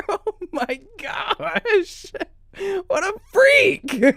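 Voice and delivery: high, slow, laughing "What a freak"; laughing disbelief. Real, not acted. Streamer reacting to the Epstein clip, laughing in disbelief. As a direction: cry it out through laughter.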